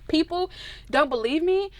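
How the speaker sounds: ordinary delivery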